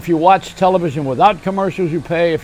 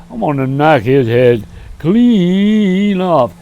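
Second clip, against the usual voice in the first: Brer Bear; 5 dB louder, much slower than usual. Retold or imitated. Storyteller voicing the bear for the kids, slow and loud. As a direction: growl it out slowly like a big dumb bear. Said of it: "I do the impressions of".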